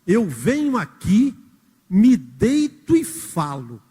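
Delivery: poem recitation. Acted. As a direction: read the lines like a solemn poem. Recites the song verse. (declamação lenta e solene)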